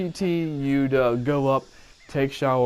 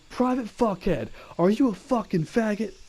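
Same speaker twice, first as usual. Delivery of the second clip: drill sergeant impression; 8 semitones higher than usual. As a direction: ham up the abusive drill sergeant. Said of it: "He goes".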